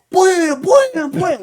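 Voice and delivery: very high, loud mock yells of blam; 1 mock slam shouts. Retelling the snowman scene, loud high blams.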